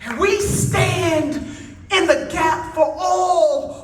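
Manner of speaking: slow, drawn-out preacher's cadence, rising; preaching at full force. Acted. Speaker rallying the union crowd, preaching slow and lifted.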